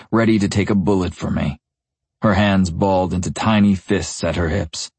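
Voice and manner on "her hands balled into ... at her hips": usual speaking voice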